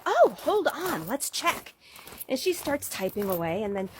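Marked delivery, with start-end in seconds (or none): 0.1-1.7 lightly voiced chirpy clerk, higher and slow; a hint of a sing-song office clerk. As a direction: play the helpful phone clerk. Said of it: "And she's like".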